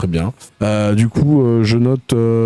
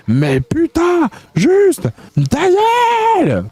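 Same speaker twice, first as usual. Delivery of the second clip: hurlé très aigu et lent, à pleine voix; cri exaspéré; slower than usual. Acted. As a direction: explose de rage sur « ta gueule ». Lit hate message, outburst. (hurlement furieux très aigu)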